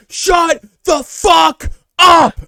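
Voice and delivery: full-throated, drawn-out high shout; retold scream. Pharmacist screaming at his coworker in a full store, enraged high shout. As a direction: scream it slowly, leaning on every word. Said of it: "and he screams".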